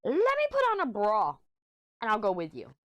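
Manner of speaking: very high, loud put-on mom voice; mom's high mock voice offering to come along. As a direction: chirp it as a mocking mom impression. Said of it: "she goes".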